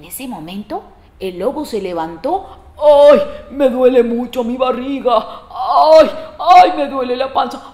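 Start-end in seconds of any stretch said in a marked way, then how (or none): 2.8-7.7 loud, theatrical groaning; wolf with bellyache. Acted. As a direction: moan it as the aching wolf.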